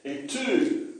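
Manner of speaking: "E tū." said up high and short; Māori command demo. Shown as a demonstration. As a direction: give the class "E tū." as a command. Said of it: "E tū was the first one I gave you".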